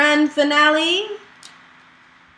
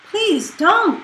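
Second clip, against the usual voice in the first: begging; 7 semitones higher than usual. Acted. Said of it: "begged Ernest".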